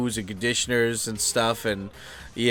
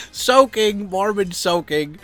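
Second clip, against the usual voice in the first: amused; 8 semitones higher, 3 dB louder, slower than usual. Real, not acted. Laughing at own innuendo.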